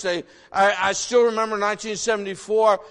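ordinary talk

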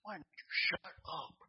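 slow whispered retort; whispered inner thought. Retold or imitated. Young Marine silently answering the preacher in a whisper. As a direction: hiss it under the breath, slowly. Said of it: "I'm thinking".

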